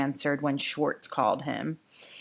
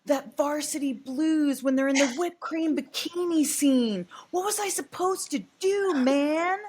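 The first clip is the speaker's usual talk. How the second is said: mock almost-crying; 9 semitones higher than usual. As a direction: whine it like a pretend cry to win pity. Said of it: "almost cry voice".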